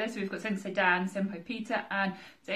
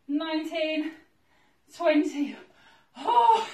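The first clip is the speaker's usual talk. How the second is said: high strained rep count with laughs; straining with effort; 6 semitones higher, slower than usual. Real, not acted. Exerciser urges herself through round three, straining. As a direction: push through the set, pitch held up.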